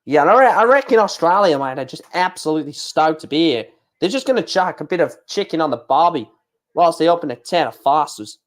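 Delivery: hammed-up broad Aussie accent; put-on Aussie twang; Australian accent. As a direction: lay on a jokey Australian accent. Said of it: "gonna have to do an Australian accent".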